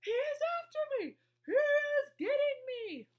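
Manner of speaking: very high, loud panicked wail; terrified screaming. A narrator's reading. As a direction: shriek as if being caught. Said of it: "screamed the flesh lump eater".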